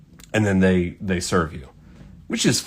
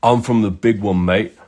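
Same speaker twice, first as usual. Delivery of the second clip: hammed-up English accent, mate; badly faked English accent; terrible English accent; 3 semitones lower than usual. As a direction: do a bad English bloke impression. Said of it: "do my terrible English accent for this".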